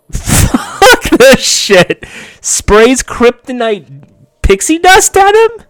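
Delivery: very high, loud, drawn-out outrage; outraged outburst. Real, not acted. Viewer outraged at Dick spraying kryptonite on Superboy, angry outburst. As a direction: explode in disbelief, stretching the words. Outraged reaction to Dick's betrayal.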